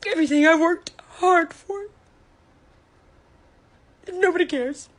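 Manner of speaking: slow, high and tearful; real grief breaking through. Real, not acted. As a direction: let the voice crack with hurt. Breaking down over foundation page.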